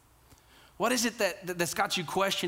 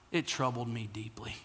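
whispered quote; 6 semitones lower, 7 dB quieter than usual. Retold or imitated. Quoting Asaph, hushed.

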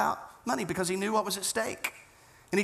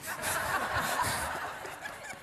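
airy, high laugh after a joke; a release of tension through laughter; breathy laugh; 11 semitones higher, 6 dB quieter than usual. Real, not acted. Laughs at his own joke.

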